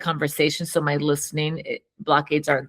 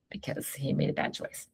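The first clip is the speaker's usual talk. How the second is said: whisper; 4 semitones lower, 13 dB quieter than usual. Real, not acted. Aside to husband.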